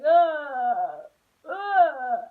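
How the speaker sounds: loud mock bawling "ugh"; mock wail. Kid walking home after a spanking, mock wailing. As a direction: imitate a kid's loud wail.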